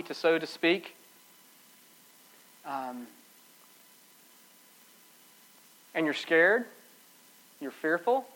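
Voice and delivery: low, very soft, spaced-out preaching; hushed pastoral appeal. Real, not acted. Preacher asks the congregation about their own fears, hushed. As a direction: bring it down to an intimate, gentle close.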